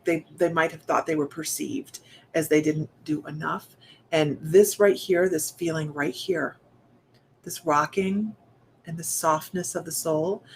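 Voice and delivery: ordinary talk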